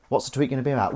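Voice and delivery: usual speaking voice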